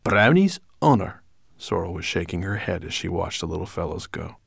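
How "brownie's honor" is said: high, loud promise; emphatic brownie voice, pitched up; pledging brownie. A narrator's reading. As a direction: swear it as a pert little brownie. Sorrel gives her promise.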